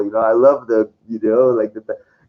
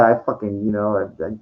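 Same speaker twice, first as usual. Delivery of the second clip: ordinary talk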